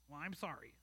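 put-on a child's reluctant, muttered sorry; grudging apology. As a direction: say sorry without meaning it. Child forced to apologize to parent.

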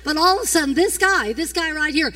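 high, loud storytelling shout; preaching at full force. Acted. Preacher retelling the pastor punching a man, shouting. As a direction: tell it big and loud to the crowd.

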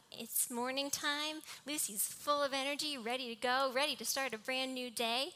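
light, bouncy morning eagerness; bubbling up a little, raring to go; mildly excited. Acted. Preacher acts out the puppy greeting morning, mildly excited.

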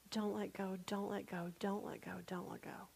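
desperate whispered pleading, almost no voice; whispered prayer. Retold or imitated. Mother on the boat praying for her children, whispered. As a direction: breathe the prayer over and over, fading. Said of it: "I was just sitting there praying".

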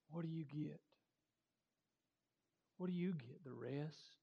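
soft, low prayer voice. Acted. Preacher praying for the congregation, softly. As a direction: pray humbly and quietly to God.

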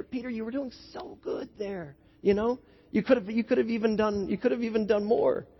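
soft, tender consoling praise; gentle encouragement. Acted. Jesus to Peter after he sank, gently encouraging. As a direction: softly encourage him after a stumble. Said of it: "I imagine Jesus saying to Peter".

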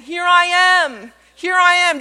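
calling out. Retold or imitated. Zacchaeus calling from the tree.

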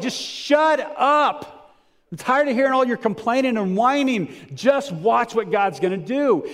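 exasperated, drawn-out high rebuke; letting them have it. Acted. Moses fed up with complaining and whining, slow exasperated scolding. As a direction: scold them slowly, pitched right up. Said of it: "Moses is just letting him have it".

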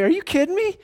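high, incredulous aside to his wife; mock disbelief. Father to wife, high mock disbelief. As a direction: sound amazed at the kid's nerve. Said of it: "I looked at Sarah and I was like".

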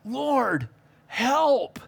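drawn-out cry for help; imploring, humbled prayer; humble plea. Acted. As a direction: plead to God from a tender heart. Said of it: "that should be our heart. Tenderhearted, humbled".

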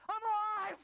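very high, loud burst of life; acted revival shout. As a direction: shout it like a patient jolted back to life. Revived patient sits up.

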